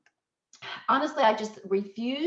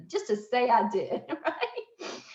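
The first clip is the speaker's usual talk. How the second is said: laughing; 4 semitones higher, slower than usual. Real, not acted. Laughs at her own plan.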